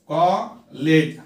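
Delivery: कॉलेज stretched slowly, both syllables clear; slow syllable read. Shown as a demonstration. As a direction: draw out कॉलेज for counting syllables. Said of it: "how many syllables are there in college".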